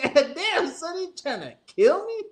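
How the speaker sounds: high-pitched mock-angry grandma; high, laughing impression of an outraged grandma; hella mad grandma. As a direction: imitate a startled grandma scolding through laughter. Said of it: "He's got hella mad".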